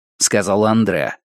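ordinary delivery

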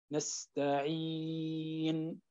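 very slow and soft, the ي in نستعين stretched long; long vowel length. Shown as a demonstration. Teacher showing the longest madd on نستعين, very slow. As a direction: draw out the ي in نستعين for six counts. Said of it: "ست حركات".

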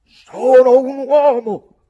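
very loud, high, drawn-out shout; furious youth bellowing; growled outburst. A narrator's reading. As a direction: roar it in wounded pride. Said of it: "ringhiai io".

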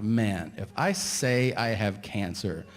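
slow, drawn-out worry; slow inner panic about jinxing himself; slow dread. Retold or imitated. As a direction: spell out the doom slowly. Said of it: "And I'm like".

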